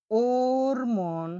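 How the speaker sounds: ওর মন, dwelling on a deep open ও; deep drawn o. Shown as a demonstration. Teacher demonstrates the deep ও in ওর মন, slow and loud. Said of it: "ও এর উপর টান থাকলে ও হয়ে থাকে গভীরভাবে".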